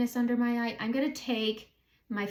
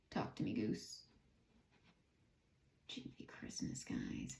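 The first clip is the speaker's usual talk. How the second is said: whispered to herself; 11 semitones lower, 13 dB quieter than usual. Real, not acted. Whispering to herself while searching.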